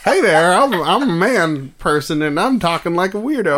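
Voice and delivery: high goofy weirdo voice; silly little voice. Shown as a demonstration. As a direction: put on a dopey high voice. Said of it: "one of my little voices".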